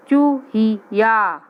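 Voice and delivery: चूहिया stretched very slowly, falling at the end; slow sounding out. Shown as a demonstration. Teacher to young pupils, first a slow चूहिया. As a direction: draw out चूहिया syllable by syllable. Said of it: "word said slowly then normally".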